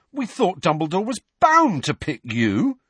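a touch of outrage; indignant. A narrator's reading. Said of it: "said George indignantly".

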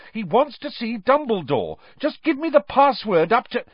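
high, urgent pleading; frantic demand. Acted. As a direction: push for the password. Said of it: "Harry shouted".

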